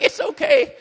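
gushing lady, laughing. Retold or imitated. Lady consoling the misnamed preacher, high and laughing. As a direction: dote on him with a laugh. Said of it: "she's like".

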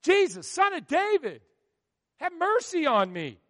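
loud, high desperate cry for mercy; shouting. Acted. Blind beggar shouting to Jesus from the roadside, desperate. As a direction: call out as loud as you can. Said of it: "He began to shout".

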